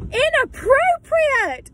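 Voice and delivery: high, drawn-out indignant cry; indignant outburst. Real, not acted. Reacting to the DJ's maid outfit joke, indignant. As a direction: sing out your disapproval, high and slow.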